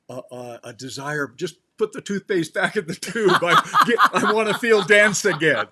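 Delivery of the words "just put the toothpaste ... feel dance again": loud, high mock plea with a laugh; mock protest. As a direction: protest loudly in play, voice pitched up. Acts out people resisting expansion.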